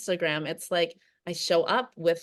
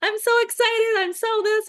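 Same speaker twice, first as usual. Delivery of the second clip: much higher and louder, squealed forced cheer; mock excitement; 7 dB louder than usual. Parodying herself forcing excitement for new clients, squealed. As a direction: overdo the fake excitement. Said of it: "show up on Instagram and my stories and be like".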